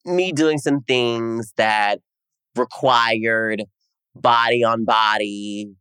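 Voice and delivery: stretched-out, teasing words; slow, suggestive spelling-out; slow coy. Retold or imitated. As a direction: savour each phrase.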